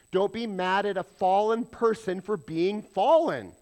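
normal talking voice

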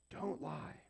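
soft, toned-down "Don't lie"; quiet command. Shown as a demonstration. Preacher lets the commandment sink in, quiet repeat. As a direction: drop "Don't lie" softly, matter-of-fact.

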